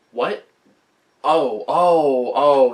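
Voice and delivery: high puzzled question, then loud dawning realization; confused, then realizing. Acted. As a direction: act out the penny dropping. Imagined player's confusion turning to realization.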